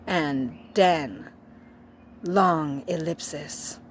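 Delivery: ordinary delivery, nothing marked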